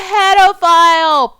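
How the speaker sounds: slow, high, loud, stretched-out pedophile; drawn-out outraged cry. Real, not acted. Vlogger naming the man in the film, stretched outraged cry.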